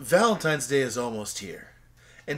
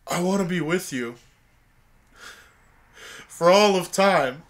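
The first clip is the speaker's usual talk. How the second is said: mock solemn vows; 2 semitones higher, 3 dB louder than usual. Groom reciting his vows, hammed-up solemn.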